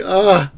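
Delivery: high loud cry into laughter; shrieking laugh. Real, not acted. Streamer shrieking with laughter at the mate. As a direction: let out a yelp and howl with laughter. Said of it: "I don't know why I'm laughing".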